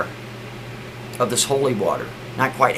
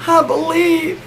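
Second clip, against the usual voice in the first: mock sobbing; 12 semitones higher, slower than usual. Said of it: "she's sobbing, she's like".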